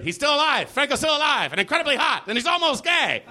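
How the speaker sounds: high, breathless mock excitement. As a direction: gush it like a thrilled gossip blog. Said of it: "what they're there for, as a daily alert".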